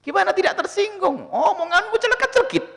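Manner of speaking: high, laughing tease of a sharp-tongued man; laughing rebuke. Acted. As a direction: scold him while laughing. Preacher scolds with a laugh. (menegur sambil tertawa)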